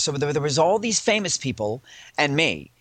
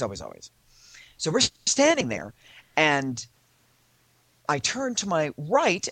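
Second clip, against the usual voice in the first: ordinary delivery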